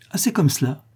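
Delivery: mildly offended, holding back angry tears; piquée. A narrator's reading. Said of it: "dit-elle, piquée, les yeux pleins de larmes de rage". (piquée au vif)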